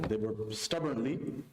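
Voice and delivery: ordinary talk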